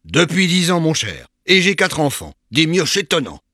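high, loud boasting cry. A narrator's reading. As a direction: shout it out proudly to his old friend. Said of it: "Il cria". (cri fier et sonore)

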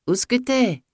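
high, impatient calling question; angry calling. A narrator's reading. Master looking for the maid upstairs, angry calling. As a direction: call it upstairs, pitched well up.

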